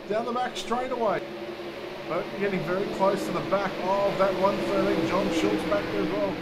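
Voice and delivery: energetic motorsport race call; live race play-by-play. Real, not acted. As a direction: call the race live, surging at passes and contact.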